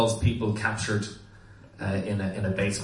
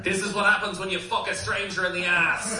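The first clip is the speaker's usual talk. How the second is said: mock shout; 9 semitones higher than usual. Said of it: "he would shout out every so often".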